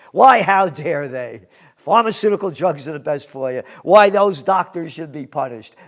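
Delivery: loud, hammed-up mock indignation in a put-on voice; sarcastic mockery. Newsman mocking pharma boosters to his viewers, loud sarcastic put-on voice. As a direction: play a mock pharma cheerleader, dripping with sarcasm.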